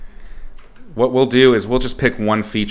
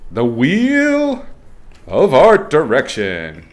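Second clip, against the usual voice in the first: slow, hammed-up reveal; mock-grand announcement; game-show announcer; 4 semitones higher, 3 dB louder, much slower than usual.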